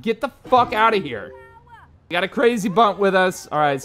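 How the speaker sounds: normal talking voice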